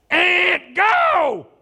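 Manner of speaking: sudden high shout. Retold or imitated. Pastor yelling at a driver on her phone, loud and high. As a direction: bark it at the stalled driver.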